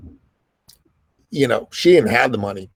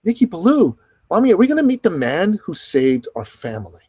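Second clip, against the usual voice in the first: put-on much higher, a little boy asking his mom; small boy's voice; 7 semitones higher than usual. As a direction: ask like a curious small child. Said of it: "My youngest son".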